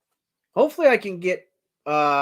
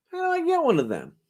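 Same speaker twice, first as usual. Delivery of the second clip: mock clerk voice. Clerk voiced in a retold story.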